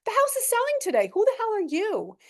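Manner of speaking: can't believe what he just heard; retold high incredulous outburst; incredulous disbelief. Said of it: "I'm like".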